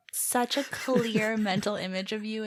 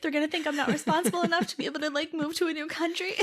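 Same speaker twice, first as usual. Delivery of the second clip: high, racing anxious thoughts; mock panic; 5 semitones higher, much faster than usual. Friend voicing the teller's panicked thoughts, mock anxious. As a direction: babble it fast in pretend worry.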